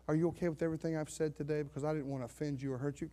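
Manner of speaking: soft, quick, anxious-to-please checking; mock meek, hushed apology; apologetic pastor. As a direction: tiptoe apologetically round their feelings. Voicing the over-careful pastor.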